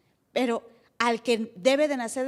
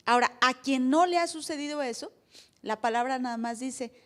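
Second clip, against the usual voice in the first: ordinary delivery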